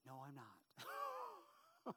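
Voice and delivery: high, drawn-out no, I'm not; a youth pastor brushing off an old lady, high and slow; laughing denial. Retold or imitated. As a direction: stretch it out in playful disbelief. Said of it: "I said".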